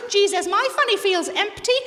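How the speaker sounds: high, loud mock complaint; blatant, hammed-up announcement; unsubtle hint. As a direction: blurt it out to grab attention. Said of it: "I didn't say they were subtle".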